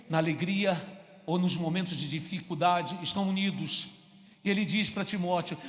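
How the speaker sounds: ordinary delivery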